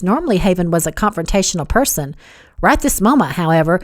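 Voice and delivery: usual speaking voice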